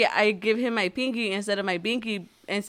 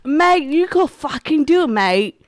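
put-on Australian accent; 4 semitones higher, 5 dB louder than usual. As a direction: cheer them on in broad Australian. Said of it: "Did you turn Australian?".

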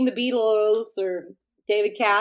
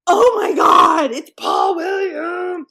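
high, slow, loud starstruck cry; starstruck gasp; 9 semitones higher, 10 dB louder, much slower than usual. Retold or imitated. Spotting her idol across the restaurant, loud excited squeal. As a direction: squeal it like a stunned fan.

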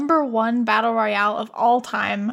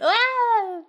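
mock scream; 11 semitones higher than usual. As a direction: imitate a falling man's scream. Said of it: "That's why he went".

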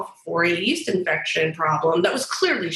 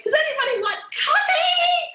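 overeager cheer. Retold or imitated. Trying to win over irritated coworkers, she offers coffee with a very high overeager chirp. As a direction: sing it out like the office's eager helper. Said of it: "I was like".

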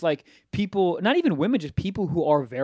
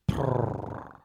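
low, soft mock purr; imitated purr; 8 semitones lower, 6 dB quieter than usual. Retelling her purr in his ear, soft mock purr. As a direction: breathe a low cat purr. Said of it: "in my ear, she's like".

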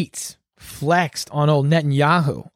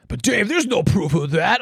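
higher-pitched put-on doubter voice; mock objector; 4 semitones higher than usual. Doubting listener objecting to Dave, mock objector. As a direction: mimic a whining critic, pitched up.

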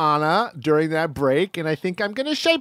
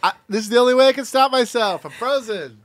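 playing frozen; 8 semitones higher, faster than usual. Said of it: "Nick is frozen in space".

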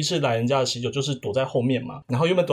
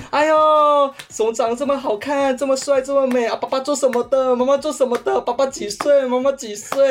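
higher, gushing auntie voice all through; imitating eager relatives; 10 semitones higher, 3 dB louder than usual. Relatives crowding a cousin's new boyfriend, gushing mimicry. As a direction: fire off the questions like excited aunties.

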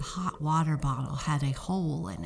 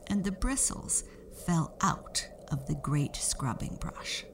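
ordinary delivery

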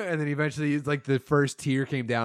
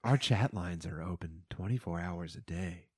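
hushed, seductive commercial read; late-night ad voice; 7 semitones lower than usual. Acted. Mimics late-night phone line commercial.

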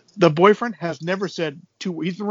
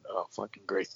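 low, soft sarcastic mutter under the breath; 11 semitones lower, 15 dB quieter than usual. Retold or imitated. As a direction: grumble it dryly to yourself. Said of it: "I'm going".

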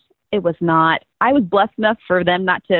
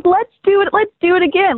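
eager; 9 semitones higher than usual. Retold or imitated. Her eager inner voice, retold.

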